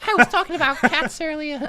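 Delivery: perky heroine voice. Acted. Heroine voice again.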